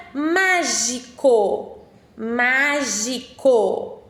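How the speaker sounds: drawn out, syllable by syllable; slow, careful dictation voice. Shown as a demonstration. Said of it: "Vou falar pausadamente".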